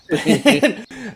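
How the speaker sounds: high burst of laughter; loud laugh. Real, not acted. Player laughs at the awkward moment, loud laugh.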